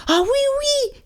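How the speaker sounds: very high squeaky eager yes; eager mouse. A narrator's reading. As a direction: jump at the offer. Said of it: "dit Souriceau avec empressement".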